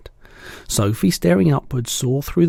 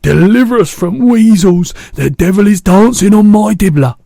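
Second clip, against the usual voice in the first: very high, loud raging wail; fury and anguish; 10 semitones higher, 12 dB louder than usual. A narrator's reading. BFG raging over the nightmare, high furious wail. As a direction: rage at the heavens. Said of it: "filled with fury and anguish".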